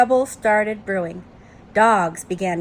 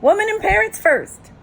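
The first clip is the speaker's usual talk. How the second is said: high, loud parrot squawk; parrot's urgent high-pitched cry; 11 semitones higher, 5 dB louder than usual. Acted. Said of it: "squawked the parrot".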